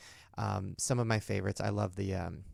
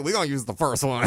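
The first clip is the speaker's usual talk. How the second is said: mock exasperated producers; 3 semitones higher, 9 dB louder than usual. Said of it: "they're all like".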